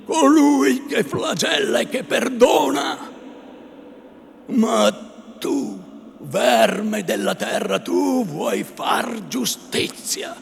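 loud high preaching thunder; full resonant rebuke. A narrator's reading. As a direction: rain judgment down on him. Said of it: "ripresa tutta l'antica pienezza e sonorità".

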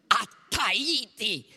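a shrill vulture-like cry of Tahiti; a mildly voiced shrieking old gossip. A narrator's reading. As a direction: screech it like a vulture. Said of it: "Sembrò lo strillo di un avvoltoio".

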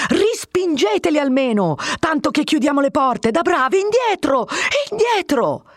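high, pressing commands to the guards; urgent orders. A narrator's reading. Captain urging guards to shut the doors. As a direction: push them to hold the door.

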